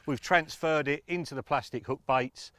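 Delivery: usual speaking voice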